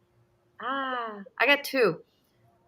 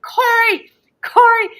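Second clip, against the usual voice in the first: loud, much higher yell of the name across the house; calling out; 11 dB louder than usual. Retold or imitated. Wife yelling for her husband to come help, loud call. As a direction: holler for your husband downstairs. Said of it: "So I'm like".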